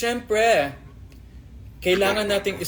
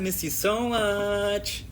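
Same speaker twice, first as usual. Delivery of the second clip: slow, higher warped voice; voice effect; 7 semitones higher, slower than usual. Shown as a demonstration. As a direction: croon it through the effect. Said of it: "Hi, Miss Rain De Guzman".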